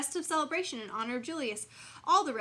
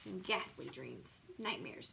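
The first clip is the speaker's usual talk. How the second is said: slow, low and soft; spooky hush; 4 semitones lower, 8 dB quieter, slower than usual. A narrator's reading. Narrator darkening the tale about Lily's nightmares, low and slow. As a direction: creep into the word ghastly.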